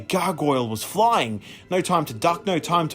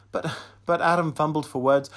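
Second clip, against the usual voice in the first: plain reading voice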